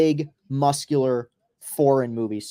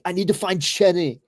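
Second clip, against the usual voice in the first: put-on higher, heavy Austrian accent, "Jenny" said as "Chenny"; Schwarzenegger Austrian accent; 6 semitones higher than usual. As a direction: Austrian action hero searching for his daughter. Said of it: "his daughter was Chenny".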